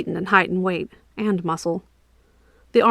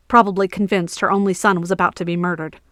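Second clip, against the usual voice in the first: usual speaking voice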